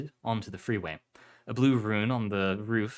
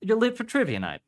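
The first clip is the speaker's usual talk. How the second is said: much higher-pitched jovial host; big pub host calling out; 13 semitones higher, 4 dB louder than usual. Acted. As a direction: call it out high across the pub. Big man voiced, accent given up.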